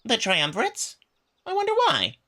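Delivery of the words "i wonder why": high, mock-innocent wondering; faux-naive question to the emperor; feigned innocence. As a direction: play dumb, sweetly innocent. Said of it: "puts on a very innocent, confused look".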